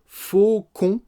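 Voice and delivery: "Faut qu'on" loud, high and separated; slow emphatic model. Shown as a demonstration. Teacher isolating two words for learners, "Faut qu'on" loud and high. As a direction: punch "Faut" and "qu'on" apart.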